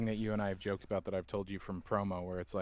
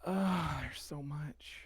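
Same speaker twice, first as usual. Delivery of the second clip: mock overwhelmed; 7 semitones higher, much slower than usual. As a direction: sigh it out high and slow, pretending to be overwhelmed. Acts out overwhelmed fan reaction.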